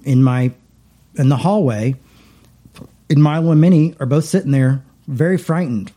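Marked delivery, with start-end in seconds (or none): none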